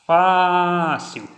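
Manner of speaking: Fácil called loudly, FÁ held long; calling out. Shown as a demonstration. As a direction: stretch the first syllable of Fácil in a call. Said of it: "Utilizando essa técnica". (chamando Fácil alto, com o FÁ alongado)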